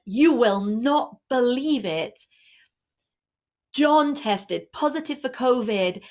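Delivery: slow, loud teenage daughter announcing bad news; teen bursting in with news. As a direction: play the daughter blurting out the shock. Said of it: "then she says".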